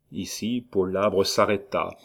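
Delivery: usual speaking voice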